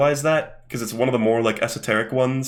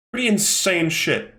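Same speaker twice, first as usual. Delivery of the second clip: normal talking voice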